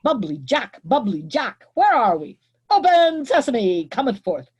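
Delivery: high, loud gobbling turkey voice; turkey gobble. Acted. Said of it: "He gobbles gluttonously with turkey wattles".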